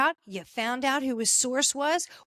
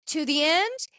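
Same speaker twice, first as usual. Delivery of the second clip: usual speaking voice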